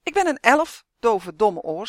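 loud, high elf outburst; angry shout. A narrator's reading. The elf insults deaf Justin, angry loud shout. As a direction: shout it indignantly, pitch leaping at first. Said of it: "schreeuwde de elf".

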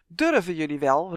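loud, indignant challenge; angry cry. A narrator's reading. Justin turning on the fairies behind him, angry. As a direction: challenge them with a touch of fury. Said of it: "riep hij woedend".

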